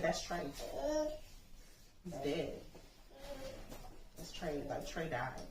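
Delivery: soft, fading, tearful realisation; numb grief. Retold or imitated. Mother realising her son is dead, soft and tearful. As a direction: let the words sink as it hits.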